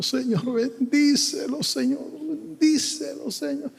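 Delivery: high, soft mock-tearful prayer; lloro imitado. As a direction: plead tearfully, pitched well up, as in a retold prayer. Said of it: "y yo llorando". (oración llorosa imitada, en voz aguda)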